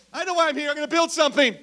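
eager Peter. Retold or imitated. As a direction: play Peter sure of his plan.

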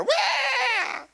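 high mock baby cry; imitated baby wail. Imitating his daughter crying after the crash. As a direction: wail like a toddler in pain.

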